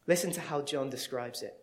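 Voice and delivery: usual speaking voice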